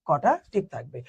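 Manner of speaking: usual speaking voice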